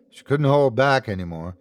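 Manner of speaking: usual speaking voice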